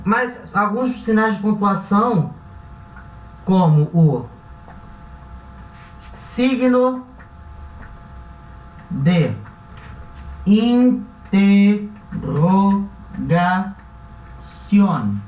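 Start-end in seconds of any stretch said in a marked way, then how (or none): 6.1-15.2 each word drawn out with long pauses; slow dictation of the Spanish term; slow teaching demo. Shown as a demonstration. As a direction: spell it out slowly for the class. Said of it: "Signo de interrogación é o nosso ponto".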